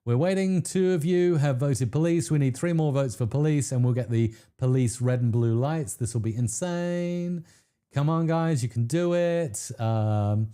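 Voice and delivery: normal talking voice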